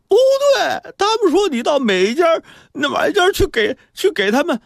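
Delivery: put-on high-pitched tearful child, faltering; acted choked-up protest. A narrator's reading. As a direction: stumble through it as if sobbing. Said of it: "哽咽着".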